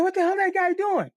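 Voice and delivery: high startled yell, what is going on; alarmed cry at a stranger; boy startled as a grown-up grabs the bike; shocked. Retold or imitated. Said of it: "I'm like".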